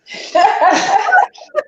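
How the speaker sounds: high laugh through tears; a real laugh breaking the crying; tearful laugh. Real, not acted.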